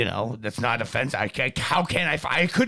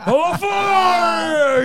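high, loud furious mock yell; angry giant shout; 12 semitones higher, 6 dB louder than usual. Retelling the giant's rage at the camera, loud mock yell. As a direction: roar it like an angry Bigfoot. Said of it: "that angry Bigfoot".

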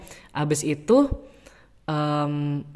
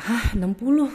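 shocked; 7 semitones higher than usual. Retold or imitated. Hearing taxi price.

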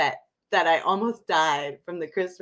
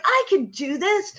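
mock pep talk; 10 semitones higher, 3 dB louder than usual. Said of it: "So I'm like".

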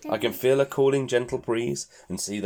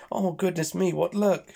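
relieved; 9 semitones higher than usual. Acted. Character relieved exclamation.

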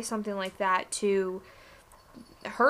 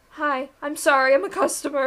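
higher, sheepish imitation of a polite customer; apologetic young customer; 5 semitones higher, 6 dB louder than usual. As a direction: imitate an overly nice young shopper apologising. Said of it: "Young people come in and are the nicest people".

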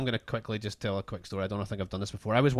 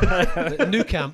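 laughing joke; 6 semitones higher, 8 dB louder, much slower than usual. Real, not acted. Joking fake address answer.

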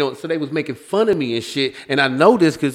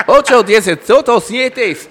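mock Mexican accent; 7 semitones higher, 5 dB louder, slower than usual. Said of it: "Mexican voice".

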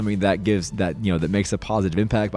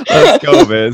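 excited; 14 dB louder, slower than usual. Real, not acted. Host laughing, hyping up.

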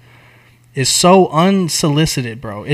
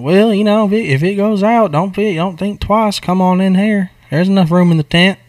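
coaxing cowboy; 5 semitones higher than usual. Retold or imitated. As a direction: sweet-talk him into the tent. Jake voiced inviting him in.

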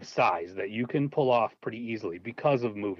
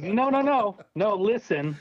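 eager laughing insistence; 9 semitones higher, 3 dB louder, slower than usual. Retold or imitated. Eagerly pitching idea to wife.

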